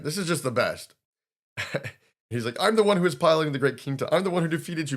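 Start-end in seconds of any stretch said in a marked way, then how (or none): 2.6-5.0 loud, high, fast bragging; boasting. Retold or imitated. Kinta claiming the girl, loud fast boast. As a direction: puff up and brag at her.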